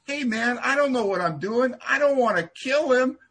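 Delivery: high, pleading holler, acted out; retold yell. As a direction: imitate calling after someone in protest. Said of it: "I yelled at his bald head".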